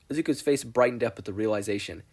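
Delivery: usual speaking voice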